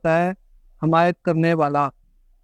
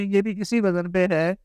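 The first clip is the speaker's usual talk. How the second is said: ordinary talk, nothing marked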